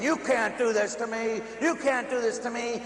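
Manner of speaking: high-pitched frantic protest; imitated nightmare scream; retold scream. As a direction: cry out in mock panic, pitch held high. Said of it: "And I'm screaming".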